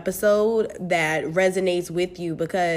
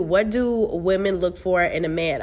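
ordinary talk, nothing marked

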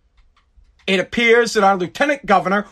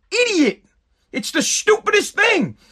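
incredulous outburst; 10 semitones higher than usual. Real, not acted. Ranting at lieutenant governor.

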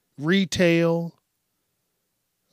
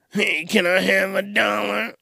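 whiny beggar voice; 5 semitones higher than usual. Retold or imitated. Mimicking hobo asking money.

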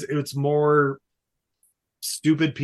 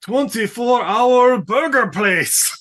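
higher, louder mock hearty laugh; hammed-up dad; 7 semitones higher, 4 dB louder, slower than usual. Dad agreeing to the deal, higher hammy laugh. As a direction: ham up a booming villain-like dad. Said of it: "the dad's like".

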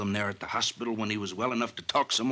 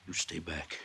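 usual speaking voice

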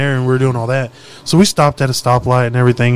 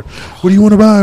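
higher, louder sales call; imitated street hawker; 8 semitones higher, 5 dB louder than usual. Dealers pressing drugs on boys in a car, imitated street hawker. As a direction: push the sale in a raised voice.

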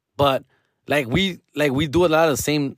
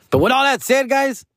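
upbeat turn; 12 semitones higher than usual. Real, not acted. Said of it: "calling out to the viewers".

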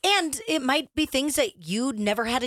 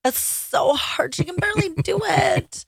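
high, shouted mock whine; mock her complaining; 10 semitones higher than usual. Mocking sister-in-law's complaint.

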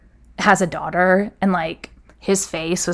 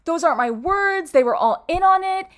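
much higher, strained tearful defence, fading softer; desperate pleading; 12 semitones higher, 3 dB quieter than usual. Retold or imitated. To HR about the burn book, a high desperate plea. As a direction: beg them to understand, voice up high.

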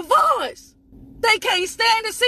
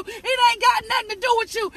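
ordinary talk, nothing marked

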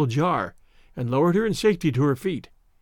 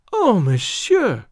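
slow, higher exclamation of gratitude; crying out; 4 semitones higher, 4 dB louder, much slower than usual. A narrator's reading. Grateful girl to Tarzan, crying out. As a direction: draw it out, pitched up and overcome. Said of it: "she cried".

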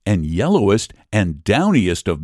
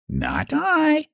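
much higher squeaky no; rat with a squeak; 4 dB quieter than usual. Acted. Rat squeaks a refusal to the hen, high voice. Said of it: "with a squeak".

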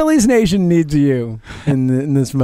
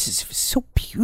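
breathy dreamy sigh of awe; mock wistful whisper; 6 semitones higher, 7 dB quieter than usual. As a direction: whisper it like a swooning fan. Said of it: "with such a wistfulness".

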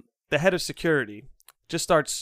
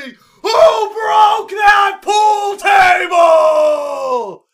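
full-blast, high drawn-out scream; exploding interrogator at top volume; 13 semitones higher, 11 dB louder, much slower than usual. Retold or imitated. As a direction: scream it, stretching every word out. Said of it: "just screams at me".

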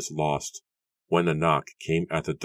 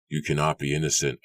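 ordinary delivery

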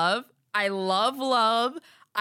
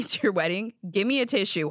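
ordinary talk

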